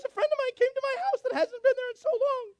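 very high, loud cartoon squeal; mimicked chirp turned way up; excitable receptionist. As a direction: imitate an over-happy woman in a tiny high voice. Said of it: "she talks like this all the time".

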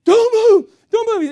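high, loud panicked shriek; panicking wife. Retold or imitated. Wife to her gasoline-soaked husband, panicking. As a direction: cry it out in urgent panic. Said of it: "my wife is panicking".

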